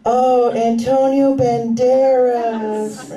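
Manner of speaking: slow swooning sigh of a name; dreamy lovestruck ladies; swooning women. Acted. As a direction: swoon over the name, drawn out slowly. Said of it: "all of you are like".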